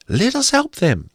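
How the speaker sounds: a kindly little fairy offering help. A narrator's reading. Forest fairy urging the others to help, high and light. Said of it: "said one".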